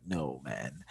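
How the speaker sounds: quiet, low retold deadpan; deadpan reply. Telling of his answer to a coworker, low quiet deadpan. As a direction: drop it low and soft, retelling your reply.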